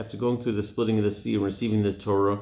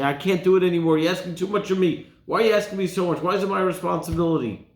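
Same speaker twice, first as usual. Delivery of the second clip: put-on louder, higher teen protest, fed up; overwhelmed teenager; 7 semitones higher, 4 dB louder, faster than usual. Teenager complaining to parent, loud and fed up. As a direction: whine it out like a kid who took on too much. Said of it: "a teenager ... they say".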